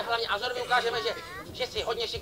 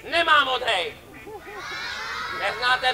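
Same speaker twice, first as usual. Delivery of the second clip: louder indignant declaration; mock protest; 4 dB louder, slower than usual. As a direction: fake outrage for the crowd. (hrané pohoršení)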